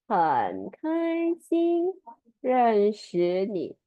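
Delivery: very slow, syllables drawn out; one by one. Shown as a demonstration. Teacher modelling "nice to meet you" in Mandarin, one by one. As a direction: stretch each syllable for learners to follow. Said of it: "one by one like this".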